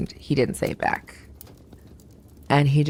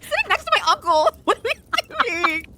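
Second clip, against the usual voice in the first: much higher, startled disbelief; shocked. Retold or imitated. Telling the host her reaction, much higher and stunned. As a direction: squeak out your shock at him. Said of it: "but I'm also like".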